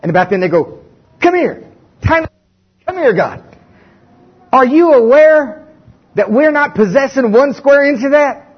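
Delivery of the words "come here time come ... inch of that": complaining Israelites. Acted. Israelites on the wilderness sand confronting God, higher and indignant. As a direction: demand an answer from God, incredulous.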